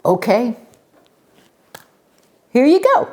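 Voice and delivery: loud, very high-pitched voice; giving in. Acted. Benny hands Schubert the apple, high-pitched. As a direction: pitch jumping way up on "here you go".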